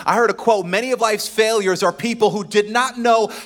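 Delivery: loud, high preacher's build; preaching at full force. Acted. Pastor preaching to the congregation, full force. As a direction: preach it out to the church.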